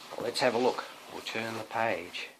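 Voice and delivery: ordinary delivery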